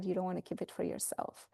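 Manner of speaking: ordinary talk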